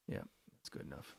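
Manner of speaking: soft unvoiced mutter; whispered aside. Real, not acted. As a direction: breathe it to yourself. Muttered to self.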